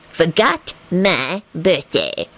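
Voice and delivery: very slow, word-by-word dictation; drawn-out, self-important note-taking; conductor writing. A narrator's reading. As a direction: say each word as you write it. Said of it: "and feverishly scribbled".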